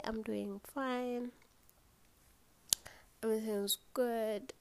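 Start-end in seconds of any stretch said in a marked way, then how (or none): none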